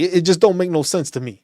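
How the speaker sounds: normal talking voice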